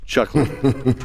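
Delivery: usual speaking voice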